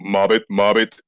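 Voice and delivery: low croaky frog mobbit. Acted. Streamer voicing the lazy eye frog, low croak. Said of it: "Lazy Eye Frog goes".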